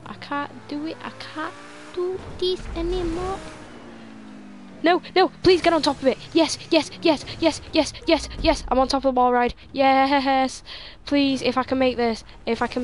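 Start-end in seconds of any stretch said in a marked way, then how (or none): 0.1-3.4 higher and softer, near tears; real despairing whine. Real, not acted. Repeated failure, despairing. 4.9-10.6 higher, louder rapid "yes"es; real excited cheering. Real, not acted. Landing on the ball ride.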